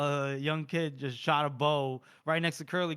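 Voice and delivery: ordinary talk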